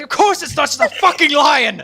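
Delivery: high, loud, slowing scoff; a bandit insists it is just a lion, scoffing loudly; scoffing shout. Acted. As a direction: yell it to calm yourself down. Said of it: "And then they're like".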